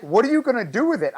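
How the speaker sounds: squeaky incredulous doctor question; baffled doctors. Retold or imitated. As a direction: ask it like a stunned surgeon, high and fast. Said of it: "they're like".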